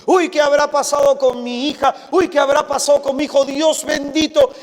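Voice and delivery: jolted awake by a midnight call; worried parent. Retold or imitated. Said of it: "suena el teléfono a las doce de la noche". (padre alarmado por una llamada a medianoche)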